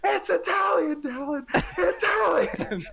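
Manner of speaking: high, laughing yell from across the room; wife loses patience and yells the answer, mock exasperated yell; imitating a yelling wife. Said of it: "she finally just yells from across the room".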